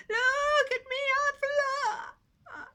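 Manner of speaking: high, loud thrilled cry; excited shout. Acted. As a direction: shout it mid-flight. Pig flying, shouting with joy.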